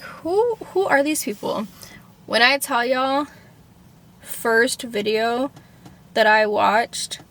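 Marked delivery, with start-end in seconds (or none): 0.0-1.7 who jumps way up, then falls baffled; incredulous squeak. Retold or imitated. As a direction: squeal the first who in mock disbelief. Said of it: "And I was like".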